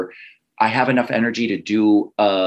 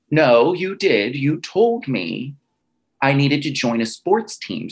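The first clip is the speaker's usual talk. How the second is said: slow, drawn-out, higher-pitched insistence; acted insistent protest; 3 semitones higher, slower than usual. Teen arguing back to mother about wrestling, acted insistent protest. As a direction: stretch each word to argue back.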